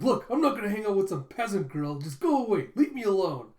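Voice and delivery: high, loud indignant yelling; acted tsundere tantrum, shouted; flustered outburst. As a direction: snap back like an embarrassed kid. Damien lashing out, embarrassed.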